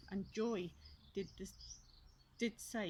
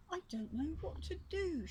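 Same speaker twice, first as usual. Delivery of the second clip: sad, higher sighing voice; 9 semitones higher than usual. Acted. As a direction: sigh it out, close to tears. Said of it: "she sighed".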